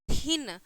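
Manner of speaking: "थिन" with aspirated dental थ; teacher's model "थिन", TH as थ. Shown as a demonstration. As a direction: say "थिन" opening on an airy Hindi थ. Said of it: "द होगा या फिर थ होगा".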